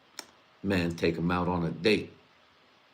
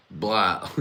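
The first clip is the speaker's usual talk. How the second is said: ordinary talk, nothing marked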